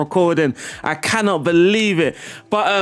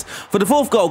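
ordinary delivery

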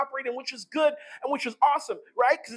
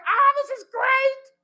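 much higher, louder, slow delighted cry; joyful Jesus; 13 semitones higher, 6 dB louder, slower than usual. Acted. Jesus rejoicing, a high slow joyful cry. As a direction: burst out with pure delight. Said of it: "He's like".

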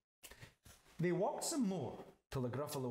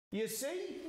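high little mouse voice; smug mouse; 10 semitones higher than usual. Acted. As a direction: show off in a high squeak. Mouse shows off to Gruffalo.

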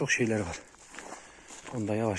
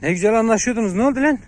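angry shout; 10 semitones higher, 8 dB louder than usual. Real, not acted. Dogs start fighting on leash.

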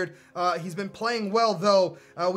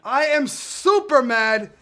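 higher, louder upset rant; real anger; 6 semitones higher, 7 dB louder than usual. Real, not acted. Gamer venting anger after a late equaliser. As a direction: vent about the late goal. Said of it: "I'm mad".

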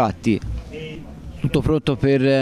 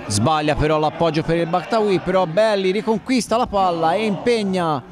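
excited play-by-play; 6 semitones higher than usual. Acted. Attack build-up commentary.

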